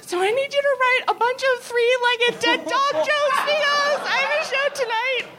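high, brassy mock old-lady voice; high Joan Rivers voice. Imitating Joan Rivers asking the writer for dead dog jokes, high put-on voice. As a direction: switch from grief to business in a high put-on voice. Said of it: "And then she was like".

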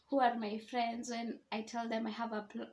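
normal talking voice

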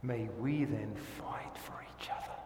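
hushed whispered plea; soft whispered exhortation. Acted. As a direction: breathe it out like a prayer.

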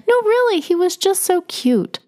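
a lightly voiced elderly woman, doting; insistent old lady. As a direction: insist sweetly like a ninety-year-old friend. Elderly Catherine insists fondly.

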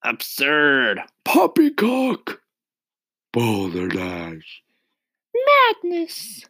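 high loud outraged cries; shouting. A narrator's reading. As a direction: bellow each word in disbelief. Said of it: "they shouted".

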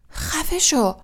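high-pitched, angry retort; Harry's narrated flare of anger; angry outburst. A narrator's reading. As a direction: cut him off in anger. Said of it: "آتش خشم سر و پای وجودش را شعله ور کرده".

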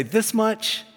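high, teasing sing-song; playful dad question. Retold or imitated. Dad asks his daughters how much he loves them, high and playful. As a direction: tease the girls.

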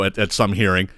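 ordinary talk, nothing marked

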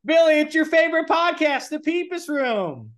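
very high sing-song greeting; high, stretched-out cheery caller voice; over-eager prank caller. Pretend. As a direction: pester him in a silly high voice. Pretend caller pestering Billy.

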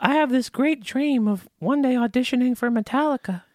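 goofy cartoon wrestler voice; Hulk Hogan impression. Guest mocks Hulk Hogan to the host in a high put-on voice. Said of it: "talks like this".

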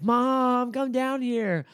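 high, drawn-out whiny call for mom; mock kid yell. Cousins calling mom to the basement, mock yelling. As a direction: call upstairs like a tattling kid, high and stretched. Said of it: "they're yelling, like".

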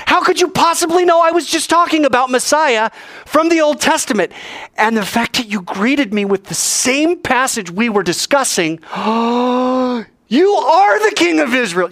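high, loud incredulous questions; shocked amazement. Acted. Nathanael overwhelmed that Jesus knew his words. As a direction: pour out stunned disbelief.

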